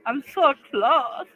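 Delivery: high, slow mock German accent. Streamer in a joke German accent near the finish. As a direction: stretch it in a silly German accent.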